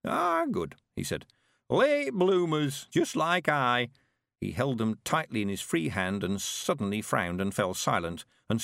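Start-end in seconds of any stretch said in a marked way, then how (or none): 0.0-0.8 put-on high, slow creaky old man voice; an eager old countryman, chuffed; old Mister Weevil. 1.7-3.9 put-on high, slow creaky old man voice; an eager old countryman, chuffed; old Mister Weevil.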